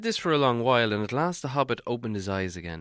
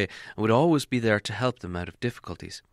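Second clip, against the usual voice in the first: usual speaking voice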